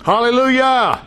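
high, long drawn-out praise shout; rousing hallelujah. Real, not acted. Preacher cheers the verses just read to the congregation, exultant. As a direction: stretch out a high, jubilant Hallelujah.

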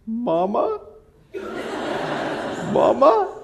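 put-on high, plaintive little-boy call; imitating a small boy crying out for his mother; child calling mom. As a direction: call for mom from upstairs, pleading. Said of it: "I could hear him upstairs".

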